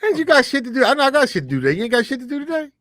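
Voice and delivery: high, pressing and impatient; exasperated. Real, not acted. Friend pressing him to hurry off the call, high and impatient.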